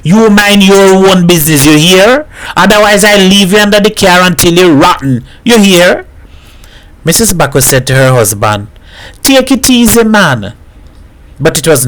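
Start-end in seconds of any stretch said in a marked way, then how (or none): 0.0-6.0 high, indignant retort; shouted back. A narrator's reading. Hat threatens the man under the car, shouting back. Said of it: "Hat shouted back". 9.2-10.5 high-pitched urging; placating. A narrator's reading. Wife tells her husband to ease off, placating.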